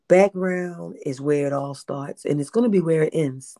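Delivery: ordinary talk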